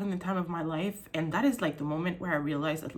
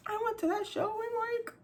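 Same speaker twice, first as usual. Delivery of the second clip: high, tearful squeak fading to a whisper; really crying while trying to keep talking; 13 semitones higher than usual. Real, not acted. Telling viewers about the best show of her life, crying. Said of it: "What the fuck am I crying?".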